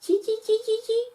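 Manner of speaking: very high squeaky chee; monkey chatter. Acted. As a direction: chatter back like a cheeky monkey.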